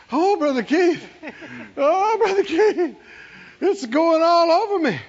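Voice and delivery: high, exclaiming retold outburst; overwhelmed excitement. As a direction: imitate a woman overcome with joy. Woman overcome as power hits her.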